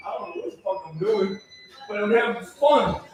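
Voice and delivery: usual speaking voice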